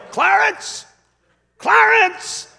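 high, booming shout of a name; yell. Retold or imitated. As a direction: yell it like calling the dead to wake. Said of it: "he just yelled at the corpse".

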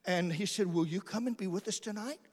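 ordinary talk

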